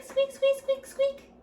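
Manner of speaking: put-on playful mouse squeaks for a kids' guessing game. Teacher imitates mouse sound.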